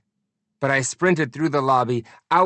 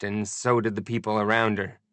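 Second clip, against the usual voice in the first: usual speaking voice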